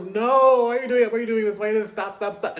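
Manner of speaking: high, loud pleading no; mock pleading shout. Retelling her reaction to the concept, loud mock pleading. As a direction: beg them to stop, loud and high. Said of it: "I was like".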